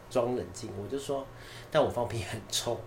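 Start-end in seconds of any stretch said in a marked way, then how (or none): 1.7-2.8 deadpan fake calm, softer; faking calm. Brushing off the boss, mock calm with a hint of laughter. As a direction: keep it cool while laughing inside.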